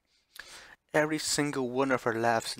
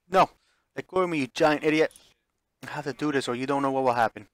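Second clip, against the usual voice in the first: loud, higher desperate yelling; struggling fury; 3 semitones higher, 9 dB louder than usual. Acted. Ashley struggling in the hero's arms, loud and frantic. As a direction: fight free while shouting.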